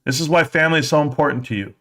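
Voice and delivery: ordinary talk, nothing marked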